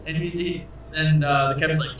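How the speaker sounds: normal talking voice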